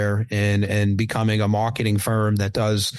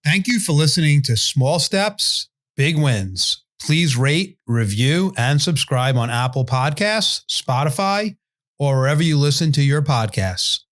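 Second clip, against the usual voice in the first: louder, higher, polished announcer read; announcer outro; 4 semitones higher, 4 dB louder than usual. Acted. Host signing off to listeners in a loud, bright announcer read. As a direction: sell the sign-off like a radio promo.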